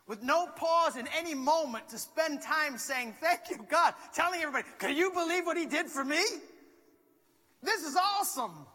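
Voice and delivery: impassioned sermon delivery; full-force preaching. Acted. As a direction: preach it out to the room.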